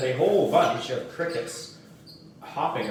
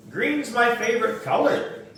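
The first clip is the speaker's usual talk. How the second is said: put-on higher little girl voice; lightly voiced girl; a hint of sweet little girl; 11 semitones higher, 4 dB louder than usual.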